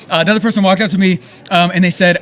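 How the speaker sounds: ordinary talk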